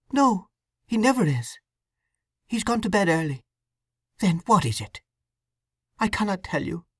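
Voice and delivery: put-on a troubled young wife confessing; young woman Sue.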